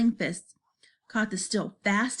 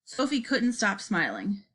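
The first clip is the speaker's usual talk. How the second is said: ordinary delivery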